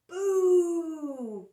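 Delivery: loud, high Boo shout; Boo cry. Acted. Spike scaring Splat with a loud high Boo. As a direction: jump out and scare them. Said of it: "cried Spike".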